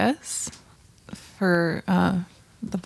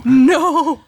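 shout; 4 semitones higher, 6 dB louder than usual. Real, not acted. Player reacts to curse.

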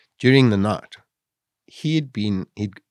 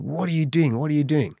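retold alarm; 5 semitones higher than usual. Swimmer watching the distant boat from the water, retold alarm. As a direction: mock-desperate "what are they doing" in a raised voice. Treading water, pleading with rescuers turning.

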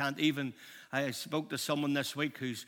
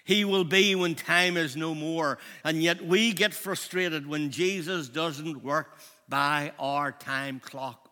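usual speaking voice

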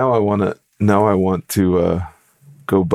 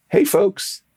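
high, bright hello from a happy biker; cheerful greeting; 12 semitones higher than usual. Retold or imitated. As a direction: call out like a grinning cyclist.

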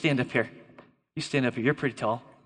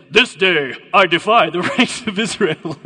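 loud villain challenge breaking into a laugh; Goliath's accented bellow; 6 dB louder than usual. Acted. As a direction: boom it out in a mock accent. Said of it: "Then the Philistine said".

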